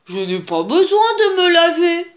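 high, loud storybook protest; indignant bear. A narrator's reading. Dirty bear refuses the she-bear's advice, indignant. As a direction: refuse hotly in a put-on bear voice.

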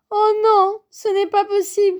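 high, loud dismayed grumble; groaning protest. A narrator's reading. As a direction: wail in exasperation at the disaster. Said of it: "grogna Grand Ours". (grogner, consterné)

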